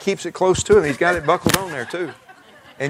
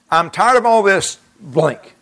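loud, slow, higher exasperated outcry; raised voice, weighting each word; retold crying out; 6 semitones higher, 6 dB louder, slower than usual. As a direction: cry it out slowly, fed up. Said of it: "and I cried out".

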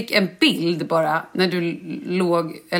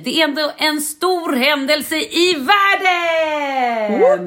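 booming slow proclamation; grand announcement; 8 semitones higher, much slower than usual. Real, not acted. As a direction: proclaim it to the whole world. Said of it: "announces grandly".

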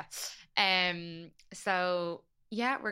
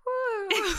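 high, louder whoop; excited woo; 5 dB louder than usual. Retold or imitated. Retelling a reaction to friends, excited woo. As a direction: let out a playful high woo.

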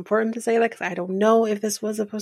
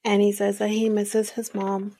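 ordinary delivery

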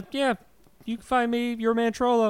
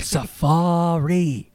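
drawn-out word; much slower than usual. Pretend. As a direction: stretch it very slowly.

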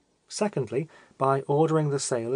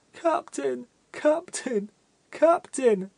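a weeping fat man crying out desperately; heart-rending calling; 11 semitones higher, much slower than usual. A narrator's reading. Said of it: "in most heart-rending accents".

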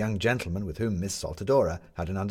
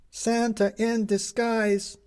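newsboy shout; 3 dB louder, much slower than usual. A narrator's reading.

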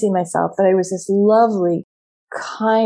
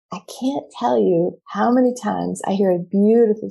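normal talking voice

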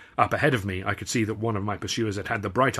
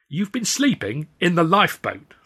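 frowning disbelief; 8 semitones higher, 5 dB louder than usual. Acted. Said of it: "the taller frowned".

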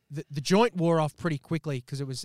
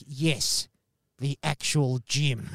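deadpan it back at him; curt reply; 2 semitones lower, much slower than usual. Retold or imitated. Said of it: "And he's like".

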